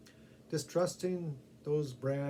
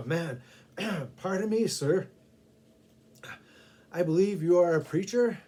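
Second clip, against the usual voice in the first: loud, throat-clearing question; man still choking on pepper sauce; hoarse after pepper; 4 semitones higher, 9 dB louder than usual. Acted. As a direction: speak through burning throat. Coughing after hot pepper sauce.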